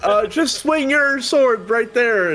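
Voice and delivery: high, loud frantic plea; shouting. Acted. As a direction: shout the order in a panicky squeal. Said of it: "He's like".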